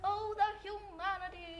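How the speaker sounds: slow, anguished cry of the famous newsman; Hindenburg reporter. Retold or imitated. Retelling the Hindenburg crash as a slow anguished reporter cry.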